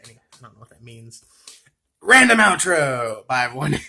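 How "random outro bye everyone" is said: mock announcement. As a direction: stretch it out like a silly announcer. Calling out a sign-off.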